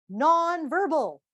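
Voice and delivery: slow, loud, punchy "Nonverbal"; emphatic answer. Shown as a demonstration. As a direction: land the answer hard. Answering own question to audience.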